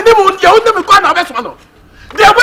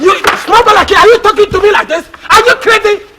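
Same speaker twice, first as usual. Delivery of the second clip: higher-pitched, angry telling-off; indignant scolding; 3 semitones higher than usual. Acted. Elder berating a small boy for talking back, indignant scolding. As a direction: scold him in rising outrage.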